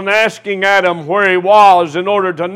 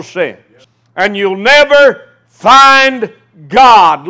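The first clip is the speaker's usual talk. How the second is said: rising, louder preacher's fervor, dragging out the key words; preaching at full force; 4 semitones higher, 6 dB louder than usual. Acted. Preacher pressing his flock on seeking joy, fervent full-force preaching. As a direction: drive it home to the congregation like a revival sermon.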